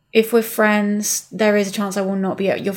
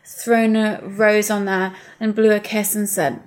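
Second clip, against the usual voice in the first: ordinary delivery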